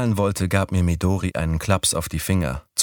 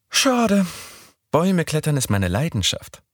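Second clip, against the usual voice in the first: put-on higher young woman's voice; Midori's voice; 10 semitones higher than usual. A narrator's reading. Narrator voicing Midori proposing Sunday, higher voice. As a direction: pitch up for the girl.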